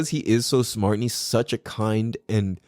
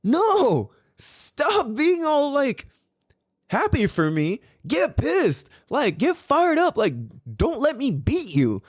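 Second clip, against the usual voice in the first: much higher, pleading hype-up; retold urging; 11 semitones higher, slower than usual. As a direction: fire up the son, half-joking. Urging son to get angry.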